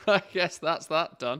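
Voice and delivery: laughing through the words; real laugh while speaking. Real, not acted. Laughing at own result.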